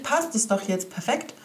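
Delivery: ordinary talk, nothing marked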